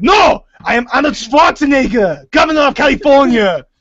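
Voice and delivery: hammed-up Arnold shouting his rank; proud bellow of his own name and office; mock proud shout. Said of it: "Arnold proclaims his title".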